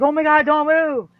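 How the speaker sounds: high, loud mock alarm; freaked out. Friend retells dad's warning in the woods, freaked out. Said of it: "'Cause he just freaked".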